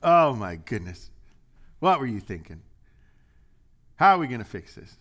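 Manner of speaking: loud, exasperated dad scolding. Retold or imitated. Dad scolding his kid over something broken, loud and exasperated. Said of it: "what's Dad do".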